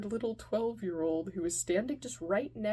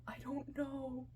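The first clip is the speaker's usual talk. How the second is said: higher, softer, helpless answer; sheepish; 5 semitones higher, 7 dB quieter than usual. Retold or imitated. Kid to mother about the wrong food, sheepish. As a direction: admit it in a small voice.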